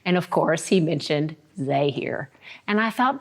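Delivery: ordinary delivery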